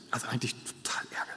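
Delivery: a quick, breathy whisper under the breath; whispered aside. Real, not acted. Preacher confiding his annoyance to the congregation, whispered.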